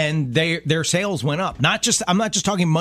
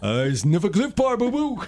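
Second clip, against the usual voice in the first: cartoon bear voice; 5 semitones higher than usual.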